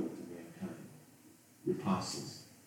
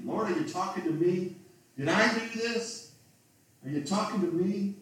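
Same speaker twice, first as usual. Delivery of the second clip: startled disciple; 10 semitones higher than usual. Acted. Preacher voicing a stunned disciple asking Jesus, high and pleading. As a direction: plead as if accused without warning.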